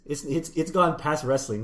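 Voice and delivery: ordinary delivery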